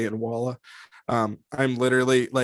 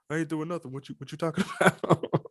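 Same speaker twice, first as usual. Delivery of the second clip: a softer street-slang reply; answering a buddy, laughing a little; quiet street-slang reply, laughing; street accent; 3 semitones higher, 4 dB quieter than usual. Retold or imitated.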